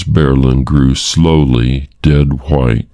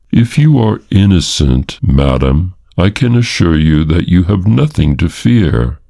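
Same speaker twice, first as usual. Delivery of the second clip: plain reading voice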